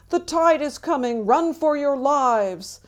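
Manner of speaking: high, loud drawn-out panicked yell; yelling in alarm. A narrator's reading. Four-year-old warning his friends of the tide, yelling in alarm. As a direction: yell a warning, stretching the last words. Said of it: "yelling to his new friends".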